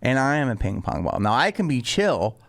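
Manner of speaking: usual speaking voice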